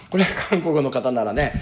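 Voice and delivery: ordinary talk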